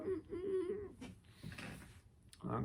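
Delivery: high squeaky held-back sob hum; imitating a friend's choked crying hum; mock choked sob. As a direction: squeak through tears like a friend fighting crying. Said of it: "he's like".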